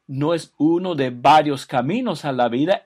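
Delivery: emphatic preaching. Acted.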